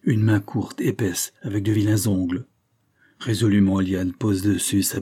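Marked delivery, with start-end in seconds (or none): none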